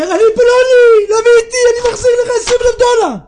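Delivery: high, loud, rushed outburst; desperate shout. Acted. Visitor announcing himself to repay twenty thousand dollars, high desperate shout. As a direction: cry it out at full pitch, fast.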